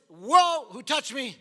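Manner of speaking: sudden loud whoa, then the question; preacher voicing a startled Jesus; startled shout. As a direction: jolt of surprise in a crowd.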